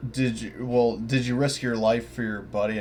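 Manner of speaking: ordinary delivery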